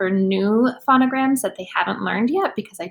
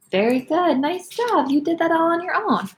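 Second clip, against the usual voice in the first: usual speaking voice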